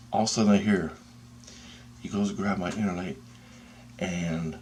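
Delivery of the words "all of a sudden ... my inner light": plain reading voice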